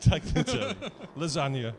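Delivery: loud hammed-up Italian accent; a jokey, over-the-top Italian accent. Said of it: "You didn't realise I was half Italian".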